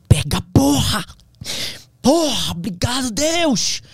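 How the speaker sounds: high, loud triumphant shout; triumphant cry. Retold or imitated. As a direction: roar of victory at each step. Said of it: "eu vou dizer as palavras que eu dizia". (grito de vitória, alto e agudo)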